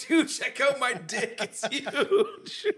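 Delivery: high, slow, laughing brag; mock boasting. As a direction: show it off giggling in a high voice. Retelling showing off in dream.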